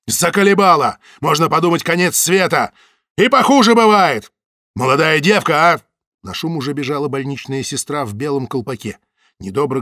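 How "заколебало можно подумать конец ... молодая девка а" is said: high, loud exasperated yelling; furious outburst. A narrator's reading. Samoylov rages at Zaytsev in the hallway, loud furious. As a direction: vent it all at him, fed up.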